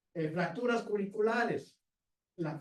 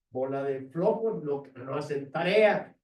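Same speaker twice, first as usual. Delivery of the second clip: loud, low-pitched scolding; acted teacher's loud reproach; mock scolding teacher; 4 semitones lower, 7 dB louder than usual. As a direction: tell off the class as a fed-up teacher.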